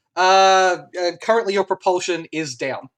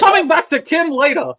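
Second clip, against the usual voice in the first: high, loud, comic cut-off; brisk, raised-voice dismissal to get a laugh; loud announcement; 9 semitones higher, 8 dB louder than usual. Real, not acted. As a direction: call it out over the table. Game master cuts off a clueless player.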